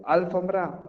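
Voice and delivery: ALfombra, AL high and loud; wrong stress on first syllable. Shown as a demonstration. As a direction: punch AL in Alfombra, the rest falling. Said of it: "¿cómo lo diría yo?".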